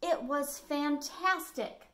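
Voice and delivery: usual speaking voice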